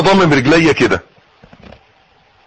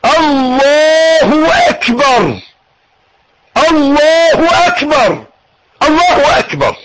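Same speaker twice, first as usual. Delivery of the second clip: fervent rising cry takbir; 11 semitones higher, much slower than usual. Shown as a demonstration. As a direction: call it out with full devotion. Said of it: "قول تاني".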